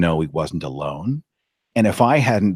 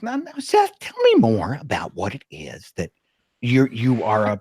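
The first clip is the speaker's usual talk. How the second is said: cousin prodding; 3 semitones higher than usual. Retold or imitated. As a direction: coax him to explain, sugary. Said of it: "she goes".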